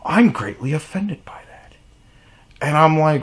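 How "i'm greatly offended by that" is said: high, breathy near-whisper of offense; put-on wounded woman's voice, airy and raised; mock offended woman. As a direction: imitate her taking offense, half whispered. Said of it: "And she was like".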